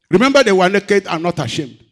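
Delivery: loud, high preacher's cry; preaching loud. Acted. Preacher crying out to the church, loud and high. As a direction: drive the point home to the congregation.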